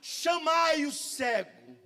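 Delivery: very slow, measured command; drawn out with weight; Jesus calling for the blind man, slow and solemn. Retold or imitated. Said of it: "aplica isso".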